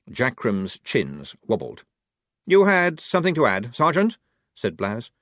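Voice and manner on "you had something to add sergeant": challenging. Acted. Blouse challenging the sergeant.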